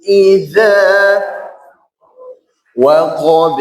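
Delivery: very slow, sustained tajwid chant; Quran recitation. Shown as a demonstration. Teacher models the verse before counting, slow chant. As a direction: chant evenly with long held nasals and vowels.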